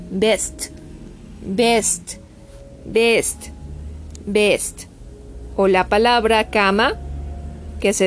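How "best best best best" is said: "best" said with a plain Spanish E. Shown as a demonstration. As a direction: model "best" as one syllable with E. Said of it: "se va a pronunciar". ("best" pronunciado con E como la nuestra)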